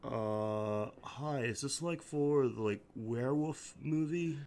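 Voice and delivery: a soft, spaced-out surfer drawl; a dazed skater asks about the movie; stoner skater. Acted.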